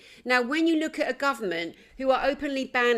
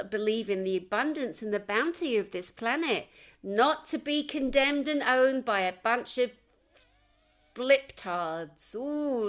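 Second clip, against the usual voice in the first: ordinary talk, nothing marked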